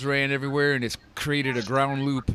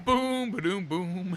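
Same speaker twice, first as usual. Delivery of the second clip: vocal drum beat; 6 semitones higher, 3 dB quieter, slower than usual. Acted. Vocal drum sound effect.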